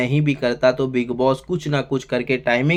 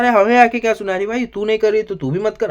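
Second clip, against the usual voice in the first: higher, mildly mocking comeback; mock retort; 7 semitones higher than usual. Reviewer voicing Gaurav brushing off Nehal, light mock retort. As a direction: toss the line back teasingly, pitched up.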